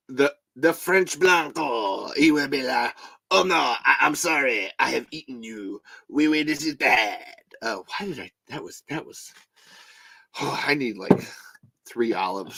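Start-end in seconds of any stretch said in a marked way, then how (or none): 0.1-7.2 lightly put-on French accent; mock French accent. Host imagines an apologetic French Graboid, mock French accent. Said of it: "what's Blanco in French?".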